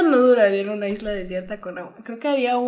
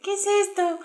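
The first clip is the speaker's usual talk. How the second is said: put-on higher, laughing kid's question; imitating the little sister; 10 semitones higher than usual. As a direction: play the confused little sister. Hermana imita a niña escupiendo. (imitando a la hermanita, aguda y riendo)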